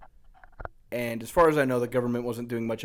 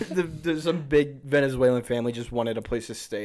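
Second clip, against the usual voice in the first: ordinary talk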